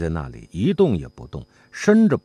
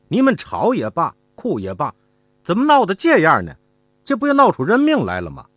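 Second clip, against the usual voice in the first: higher, louder, harsh scolding voice, quickening at the end; stern sharp rebuke; 9 semitones higher, 4 dB louder than usual. A narrator's reading. Inn manager scolds the quarrelling mother and son, higher, louder and sharp. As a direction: snap at them like an alarmed inn manager. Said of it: "又厉声说".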